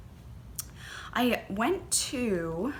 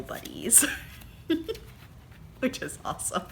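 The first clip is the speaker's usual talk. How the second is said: giggly whisper; 6 semitones higher, 5 dB quieter than usual. Real, not acted. Vlogger telling viewers about cowl buddies, in a giggly whisper. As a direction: whisper it like a little secret.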